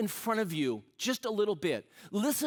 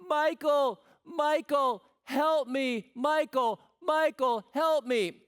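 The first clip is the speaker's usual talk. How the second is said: high, loud imitated plea to a husband; crying out for help; 7 semitones higher, 4 dB louder, slower than usual. Woman stuck with huge luggage calling her husband, high loud mock plea. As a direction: call after him like you're stuck and desperate. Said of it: "she's crying out".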